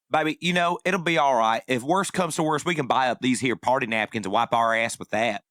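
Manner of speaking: put-on Tennessee mother's accent, reassuring her grown daughter; imitated Tennessee accent. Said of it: "in this exact tone of voice. I'm going to get this accent right".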